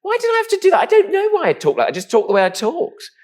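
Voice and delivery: high, loud, indignant outburst; exasperated protest. Real, not acted. Man objecting to explaining his voice, exasperated. Protesting at having to justify himself.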